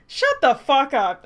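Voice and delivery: slow, loud, drawn-out yell; loud slow scream of rage; furious shout. Real, not acted. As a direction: bellow it at the page, word by word. Yelling at the character.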